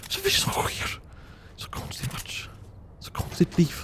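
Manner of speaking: soft bewildered whisper. Real, not acted. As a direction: whisper it to yourself, puzzled. Muttering to himself, bewildered. (förvirrad viskning)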